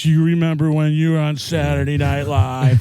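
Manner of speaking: lightly voiced starstruck interviewer; a hint of gushing fan in an imitation; Chris Farley. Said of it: "I did a Chris Farley show skit".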